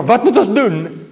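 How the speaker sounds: loud, high, slow cry; acted desperate question; deeply gripped plea. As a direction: cry out, stricken to the heart. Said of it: "die ouens staan diep aangegryp".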